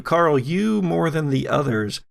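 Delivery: normal talking voice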